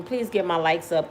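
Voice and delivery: ordinary talk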